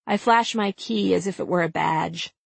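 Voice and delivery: ordinary delivery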